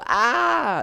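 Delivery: high little imitated yap; husky yap. Husky owner imitating a yappy husky, little high yap. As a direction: squeak it out like a floofy husky. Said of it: "and they go".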